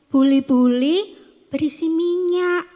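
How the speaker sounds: high, loud storybook announcement; acted realization. Widow telling the prophet about her jar of oil, high acted reveal.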